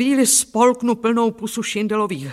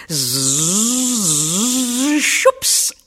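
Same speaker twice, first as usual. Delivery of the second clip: long buzzing saw noise, quick high finish; drawn-out zzz with a sudden whoosh; imitated sawing; 3 semitones higher, 4 dB louder than usual. A narrator's reading. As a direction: buzz like a saw, then pop. Imitates saw slicing through wood.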